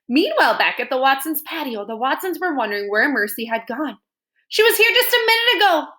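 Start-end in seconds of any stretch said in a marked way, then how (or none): 4.5-5.9 high, loud fussy lady; Mrs Watson voice. Acted. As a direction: lift it up into a worried lady. Mrs Watson voiced in read-aloud.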